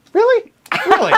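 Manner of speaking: sharp high jump of disbelief, then falls back; mock-outraged double take; a squeaky, teasing really, really; incredulous really. Real, not acted. Mock disbelief at partner's jab.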